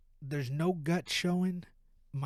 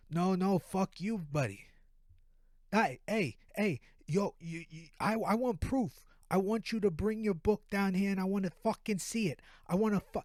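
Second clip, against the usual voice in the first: heated East Coast phone argument, higher pitched; East Coast accent; 5 semitones higher, 3 dB louder than usual. Acted. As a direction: escalate into an East Coast rant. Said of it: "East Coast accent".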